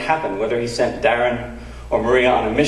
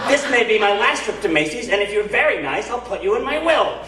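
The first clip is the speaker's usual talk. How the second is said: Dave's voice; 7 semitones higher than usual. Retold or imitated. Dave voiced in retold story.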